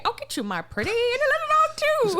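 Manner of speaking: high cackly witch voice; hammed-up movie witch threat; Wizard of Oz witch. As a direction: screech it high like a wicked witch. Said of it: "I was thinking Wizard of Oz".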